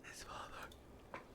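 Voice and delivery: voiceless, slow conspiratorial whisper. Real, not acted. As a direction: whisper it like a juicy secret. Conspiratorial aside.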